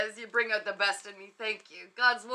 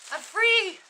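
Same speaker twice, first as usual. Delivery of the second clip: relieved cheer; 9 semitones higher, 6 dB louder than usual. Real, not acted. Freed from the costume.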